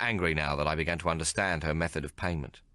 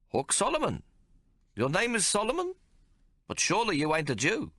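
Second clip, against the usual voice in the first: high, incredulous little man; a small man who can't believe it; puzzled tailor; 14 semitones higher than usual. A narrator's reading.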